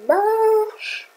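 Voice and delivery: MARCHE stretched out long and high; long, high-pitched "Marche"; drawn-out plea. Real, not acted. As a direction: stretch the vowel of Marche high, willing it to work. Willing the program to work.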